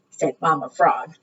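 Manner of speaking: ordinary delivery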